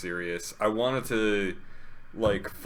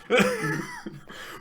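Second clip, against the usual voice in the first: squealing giggle; high squeaky laugh. Real, not acted.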